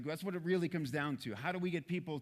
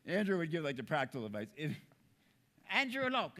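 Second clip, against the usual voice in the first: ordinary talk, nothing marked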